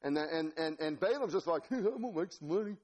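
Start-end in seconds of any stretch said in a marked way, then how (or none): 1.7-2.8 higher-pitched dopey country yokel; dim-witted Balaam. Acted. Preacher mimics Balaam riding along, dopey. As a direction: play him as a happy simpleton. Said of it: "he's just dumb as a stump".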